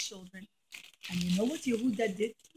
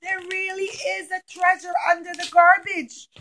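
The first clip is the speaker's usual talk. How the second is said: loud, high cheer; thrilled crowd calling out; excited; 6 semitones higher, 8 dB louder than usual. Acted. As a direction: cheer like you found treasure. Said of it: "they were so excited".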